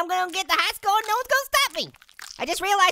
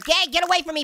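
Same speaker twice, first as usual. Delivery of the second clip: ordinary delivery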